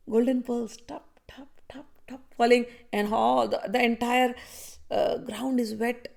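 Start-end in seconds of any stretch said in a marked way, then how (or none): 0.9-2.2 soft whispered dripping sounds; whispered tap tap. Shown as a demonstration. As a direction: whisper each tap like a falling drop. Water drops falling again.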